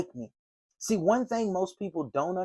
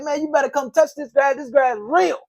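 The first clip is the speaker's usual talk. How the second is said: higher, louder amused comeback; retold laughing reply; 10 semitones higher, 6 dB louder than usual. Homeowner laughing back at the salesman, amused and loud. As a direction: answer the tease with a grin.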